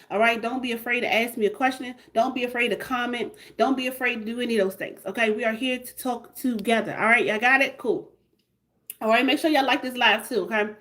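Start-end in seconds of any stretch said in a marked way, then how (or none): none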